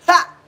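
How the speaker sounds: short, high-pitched ha. Pretend. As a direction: yelp a quick high ha. High ad-lib among whoops.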